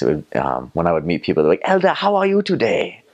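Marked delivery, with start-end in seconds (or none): 1.6-3.0 very high, lilting Zimbabwean greeting; Zimbabwean English accent. Retold or imitated. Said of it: "let's see if I can still do this".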